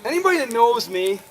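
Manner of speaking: normal talking voice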